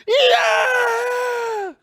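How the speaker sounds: long, high strained wail; high drawn-out impression yell; mock tortured fish cry. Acts out the fish noise.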